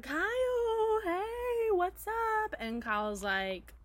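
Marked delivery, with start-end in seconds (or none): 0.0-2.5 high, sugary greeting; fake-sweet cheerful hello; sweet girl voice.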